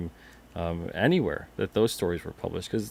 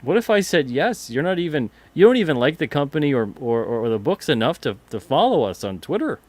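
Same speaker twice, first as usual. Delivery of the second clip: higher, louder incredulous retort; exasperated disbelief, voice pitched up; mock incredulous; 6 semitones higher, 6 dB louder than usual. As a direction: act out baffled indignation. Acting out imagined reply to submitters.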